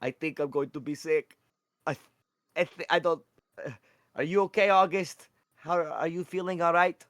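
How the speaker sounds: high, faltering and concerned; voiced Pickle Juice. Pickle Juice, sickened, checks on August in a high character voice.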